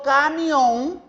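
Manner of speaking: "camión" drawn out slowly, weight on the final "ón"; stress on last syllable. Shown as a demonstration. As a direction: lean on the end of "camión", slowly. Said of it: "lleva la sílaba tónica en la última sílaba". («camión» lento, con énfasis en la última sílaba)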